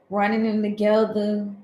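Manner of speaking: ordinary talk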